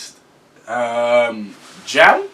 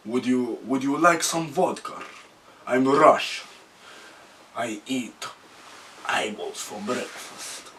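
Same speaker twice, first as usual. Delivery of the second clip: put-on soft, low Russian accent, ending in a whisper; menacing Russian tough guy, quietly; lightly done Russian accent; 3 semitones lower, 7 dB quieter, slower than usual. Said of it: "if that was a good Russian one".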